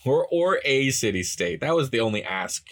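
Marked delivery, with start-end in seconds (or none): none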